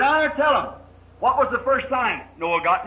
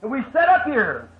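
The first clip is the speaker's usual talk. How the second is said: higher-pitched, pleading complaint; nagging son; 4 semitones higher than usual. Acted. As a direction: complain to Dad in a raised, insistent voice. Son nags Noah inside the ark.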